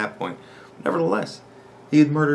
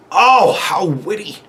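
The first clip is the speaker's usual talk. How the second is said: loud, high, drawn-out sneer; scathing sarcasm; 7 semitones higher, 7 dB louder, slower than usual. Real, not acted. Vlogger answering a commenter's lame comeback, loud sarcasm. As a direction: stretch it out in loud mock admiration. Sarcastic reply to mocked post.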